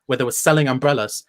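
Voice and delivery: usual speaking voice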